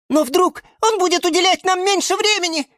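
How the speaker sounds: tiny, very high worried voice; frightened squeak. A narrator's reading. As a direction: squeak it like a scared little flower. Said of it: "испуганно пискнула фиалка Наталка". (испуганно пискнуть тоненьким голоском)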